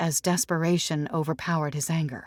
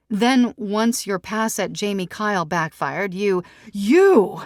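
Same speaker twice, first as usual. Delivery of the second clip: higher and louder, building to an accusation; lightly voiced Sarah, pointed; 6 semitones higher, 6 dB louder than usual. Sarah confronts Tom about his blog, pointed and raised. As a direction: lay out his history, pointedly.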